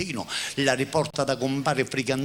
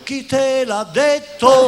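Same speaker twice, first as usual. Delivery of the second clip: mock retort; 12 semitones higher, 10 dB louder, much slower than usual. Acted quote, high slow.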